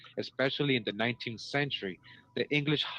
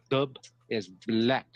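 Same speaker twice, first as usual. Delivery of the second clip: slow emphatic explanation; much slower than usual. Shown as a demonstration. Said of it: "What does Dublin mean again?".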